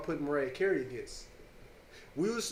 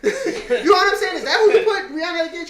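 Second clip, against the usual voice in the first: loud, high, laughing protest; laughing incredulity; 12 semitones higher, 8 dB louder than usual. Real, not acted. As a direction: argue through laughter, voice pitched way up. Laughing at own absurd suggestion.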